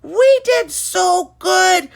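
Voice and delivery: high, loud victory cheer; cheering hooray. Acted. Athletes cheering their win, loud and high. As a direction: shout it out like champions. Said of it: "raising up their arms ... saying".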